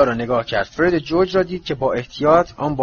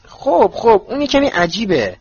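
flustered high voice; 7 semitones higher, 4 dB louder than usual. Acted. Hermione flustered reply.

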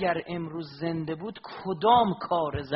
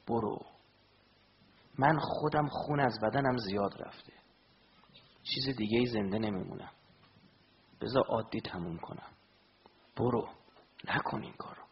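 dying Imam's plea; 5 semitones lower, 4 dB quieter than usual. Acted. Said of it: "حضرت بهش گفت".

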